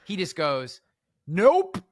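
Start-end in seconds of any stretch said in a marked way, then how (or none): 1.3-1.8 high, loud imitated heckle; heckler yelling out. Heckler interrupting the speaker's presentation, mock yell. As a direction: call out a rising objection, mockingly. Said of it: "I've had guys yell out".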